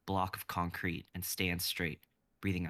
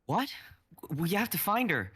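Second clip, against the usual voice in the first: urgent outburst; 12 semitones higher, 6 dB louder than usual. Acted. Kai panics about missing friend.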